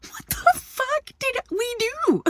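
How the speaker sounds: high, slow, stunned disbelief; baffled outrage. Retold or imitated. Replaying her shock at the kid flipping them off, high and slow. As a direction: retell your shock at the rude kid, way up high. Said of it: "and I was like".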